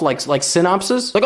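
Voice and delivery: ordinary delivery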